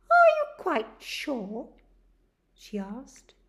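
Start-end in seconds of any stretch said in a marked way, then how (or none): 0.1-1.7 higher, louder nurse voice; nurse questioning. Acted. Nurse asks Charlie if he is sure, higher. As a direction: gently check his decision.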